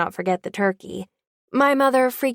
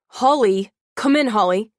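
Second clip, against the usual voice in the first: louder urgent calling; almost shouting; 5 dB louder than usual. Acted. Grant calls Holly back, almost shouting, louder. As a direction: call her back to the room. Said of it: "Grant was almost shouting".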